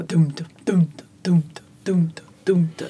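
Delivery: dum, dum, dum even and loud like a drumbeat; drumming stress. Shown as a demonstration. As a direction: beat out dum, dum, dum evenly. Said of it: "supposed to sound like drumming".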